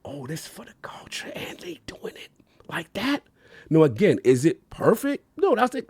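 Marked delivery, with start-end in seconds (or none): 0.0-3.2 hushed, voiceless amazement; awed whisper. Retold or imitated. Gamer reacting to a game made for the culture, awed whisper. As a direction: drop into a soft whisper of disbelief.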